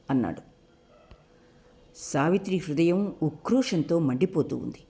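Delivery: ordinary delivery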